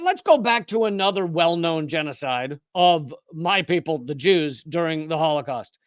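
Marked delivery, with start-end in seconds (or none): none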